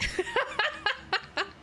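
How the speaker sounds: short high-pitched giggle; bright little laugh. Real, not acted. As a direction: giggle at your own joke. Laughs at own impression.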